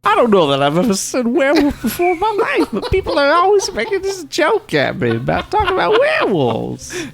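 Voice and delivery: very high, bewildered star impression; John Travolta. Star protests the werewolf joke, hammed-up high John Travolta. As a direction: protest in a very high mock celebrity voice. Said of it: "done a John Travolta".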